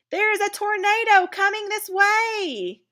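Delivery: alarmed warning. Shown as a demonstration. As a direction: shout the danger out. Demo of an alarmed exclamation.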